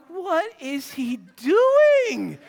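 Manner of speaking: slow, high words through laughter; dad half-crying with laughter; laughing disbelief. Retold or imitated. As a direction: crack up in disbelief.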